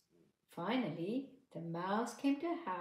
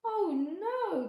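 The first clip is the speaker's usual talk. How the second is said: much higher, louder mouse protest; crying out; 12 semitones higher, 7 dB louder than usual. Acted. Mouse protesting to the farmer, high and loud. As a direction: squeal a mock-shocked no. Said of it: "cried the mouse".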